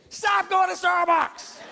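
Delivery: high, slow mock-scolding yell; sudden shout. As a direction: bark it at the crowd like a command. Pastor shouting at congregation.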